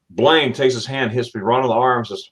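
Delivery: ordinary delivery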